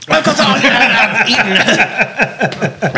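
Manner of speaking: laughing through the words. Real, not acted. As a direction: protest while laughing. Laughing while protesting.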